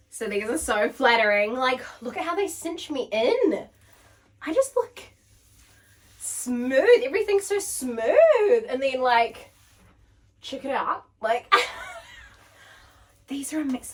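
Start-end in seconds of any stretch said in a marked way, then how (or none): 4.4-8.6 delighted. Real, not acted. Delighted by the fit.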